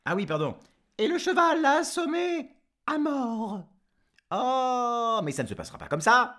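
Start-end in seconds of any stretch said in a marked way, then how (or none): 1.0-3.6 much higher, put-on voice of a farm wife; sorry farm wife. La maîtresse reprend son récit. 4.3-6.4 loud, fast outraged protest; indignant protest. Acted. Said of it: "dit Jean-Jean".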